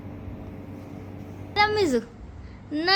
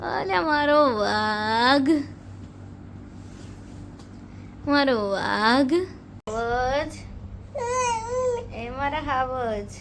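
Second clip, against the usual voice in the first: tender lullaby coo; 5 dB quieter, much slower than usual. Real, not acted. Tender soothing to sleepy baby.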